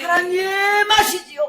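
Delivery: drawn-out cry of 아시지요, loud; loud slow plea. Retold or imitated. Preacher acting his prayer, loud slow plea. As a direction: plead slowly and loudly to God. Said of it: "기도하는 거예요".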